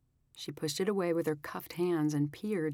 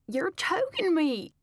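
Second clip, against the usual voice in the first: high, slow, strained voice; gasping bride; 13 semitones higher, 5 dB louder, slower than usual. A narrator's reading. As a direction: gasp it out while choked. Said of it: "Delaney gasped".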